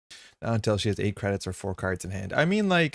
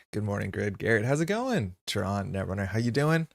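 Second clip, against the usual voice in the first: ordinary talk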